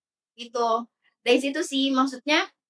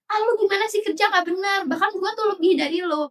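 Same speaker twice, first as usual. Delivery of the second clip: higher, complaining jab at a partner; mock nagging spouse; 7 semitones higher than usual. Spouse belittling a partner in a fight, high scornful nag. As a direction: throw a scornful put-down, pitched up.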